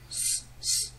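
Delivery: hissed unvoiced s sound; voiceless s. Shown as a demonstration. Teacher demonstrates a voiceless s hiss. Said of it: "il s'agit d'un S sourd".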